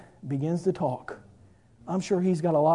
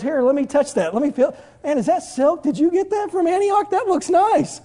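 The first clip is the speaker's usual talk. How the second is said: climbing higher and higher, gushing; paralytic admiring silk; 11 semitones higher, 6 dB louder than usual. Acted. Healed paralytic fingering the woman's dress, gushing ever higher. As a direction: fuss over the fabric with rising glee. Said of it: "And the paralytic goes".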